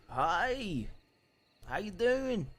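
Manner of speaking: high, loud friendly greeting; put-on greeting voice. Shown as a demonstration. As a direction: try out a voice for him. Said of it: "could I try doing a few different things".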